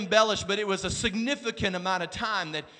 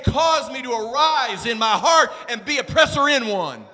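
louder, higher, pressing call to the church; preaching at full force; 8 semitones higher, 9 dB louder than usual. Acted. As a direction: preach it hard, driving each line home. Preaching climax to congregation.

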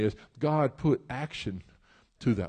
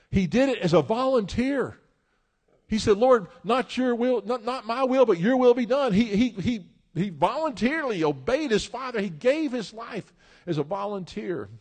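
loud, higher, driving sermon voice; full-force preaching; 10 semitones higher, 4 dB louder than usual. Acted. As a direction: preach it with rising fire.

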